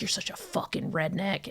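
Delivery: hushed retold insult, well under normal volume; imitated quiet insult. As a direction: deliver the insult softly, as the boyfriend did. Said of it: "saying it all so fucking quiet".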